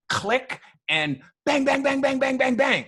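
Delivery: high, loud, rapid bangs; firing off shots; camera shutter noises. Retold or imitated. Photographer mimicking his camera clicking away.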